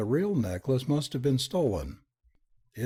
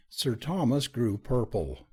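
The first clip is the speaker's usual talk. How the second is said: usual speaking voice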